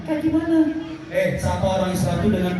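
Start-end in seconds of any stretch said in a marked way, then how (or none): 0.0-0.6 monkey puppet voice. Acted. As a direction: squeak it like a shy monkey puppet.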